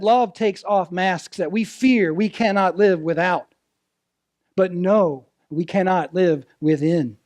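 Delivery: normal talking voice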